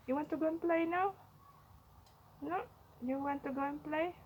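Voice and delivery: fast high coaxing; playful pet talk. Real, not acted. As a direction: tease the bunny into play.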